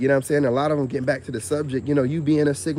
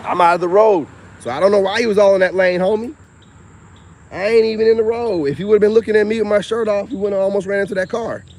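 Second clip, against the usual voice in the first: louder, higher call to a passing car; calling out to driver; 6 semitones higher, 5 dB louder than usual. Real, not acted. To a passing driver, a loud annoyed call. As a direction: shout it out across the road.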